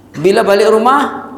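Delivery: ordinary delivery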